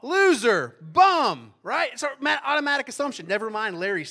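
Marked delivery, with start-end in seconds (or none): 0.0-1.5 louder, higher shouted "Loser, bum"; called-out answer. Shown as a demonstration. Preacher prompting the congregation, loud call-out. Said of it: "just say it".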